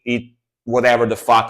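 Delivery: ordinary delivery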